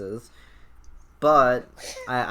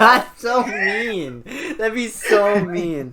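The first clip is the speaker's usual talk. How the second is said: slow, higher, laughing through the words; giggling it out slowly; 9 semitones higher, 7 dB louder, much slower than usual. Real, not acted. Laughing at friend's suggestion.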